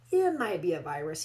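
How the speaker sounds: a loud, confident expert's pronouncement; announced with authority. Acted. Doctor to the worried parents, loudly authoritative. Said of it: "he announced with authority".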